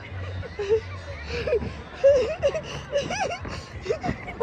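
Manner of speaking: long, high peals of laughter; helpless laughing. Real, not acted. Vlogger after friend's predicted fall, helpless laughing. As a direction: laugh until it squeals and nearly cries. Teasing friend who fell.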